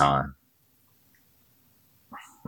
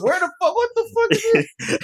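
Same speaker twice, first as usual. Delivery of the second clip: high, squeaky mock disbelief; acted-out high-pitched bafflement; baffled reaction. Host retells his reaction to strange slang, high and incredulous.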